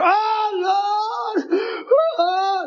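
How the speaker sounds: high, drawn-out mock wail; imitating a holler. Preacher mimics woman interrupting his sermon, high hollering. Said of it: "and she'd holler".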